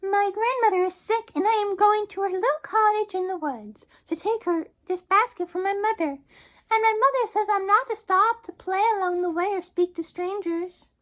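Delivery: put-on little girl voice. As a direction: chatter brightly like a trusting child. Girl answers the wolf.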